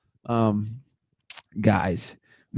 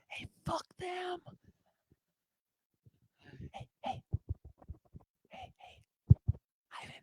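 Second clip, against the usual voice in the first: conspiratorial whisper; 14 dB quieter than usual. Pretend. Secret whisper to son Ivan.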